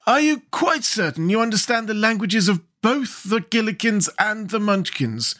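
high-pitched, questioning Scarecrow king; doubting Scarecrow. Acted. Scarecrow tests his interpreter, high and doubting. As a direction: question closely in a high character voice.

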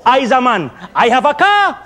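ordinary talk, nothing marked

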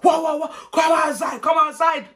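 loud, high rapid-fire shouts; imitated screaming. Esther screams at auntie with finger guns, loud high shouts. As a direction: scream the challenge over and over. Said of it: "I'm screaming".

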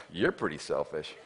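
low, slow, soft retort; under-the-breath backtalk. Retold or imitated. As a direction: say it quietly, as if not daring to. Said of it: "you didn't talk like this".

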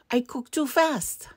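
high, brisk rabbit excuse; hurried rabbit. A narrator's reading. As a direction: pipe it up quickly. Rabbit character, high and quick.

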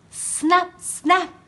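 high little snap snap; snapping teeth. A narrator's reading. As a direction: snap it playfully. Teeth snap sound effect.

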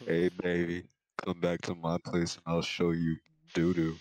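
loud hammed-up sleazy come-on; sexy voice.

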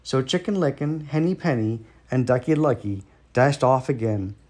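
usual speaking voice

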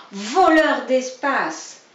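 angry rabbit retorting to his neighbour. Acted. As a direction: fire the insult back. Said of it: "répliquait monsieur Grisou".

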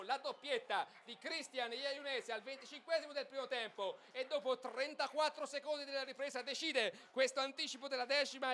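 usual speaking voice

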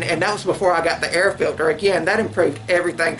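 muffled behind cloth; through a bandana. Real, not acted. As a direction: fabric covering mouth.

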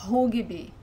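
rugby with an oo vowel and the R as H; Portuguese U. Shown as a demonstration. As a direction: say rugby as "HOO-ghee-bee". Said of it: "in real Portuguese we would read".

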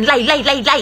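"ไหล" repeated identically, no final consonant; endings dropped. Shown as a demonstration. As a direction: mimic like, rice, light, right all blurred into "ไหล". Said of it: "ถ้าเล่าบ่ออกเสียงหน้าเสียงถ่ายชัดเจน". ("ไหล" ซ้ำๆ ไม่มีเสียงท้าย)

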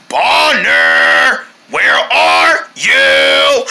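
slow, drawn-out creepy call; scary Tun. Acted. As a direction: hunt someone hiding, stretching the words. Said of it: "not the scary Tun".

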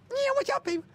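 squeaky, very high-pitched; a chipmunk voice; helium voice. Pretend. Squeaky greeting to viewers.

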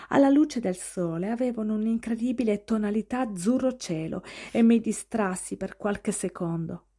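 plain reading voice

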